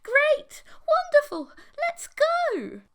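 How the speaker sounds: very high, very loud squeals of joy; ecstatic. Acted. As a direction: shriek with giddy excitement. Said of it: "giggled and spun around".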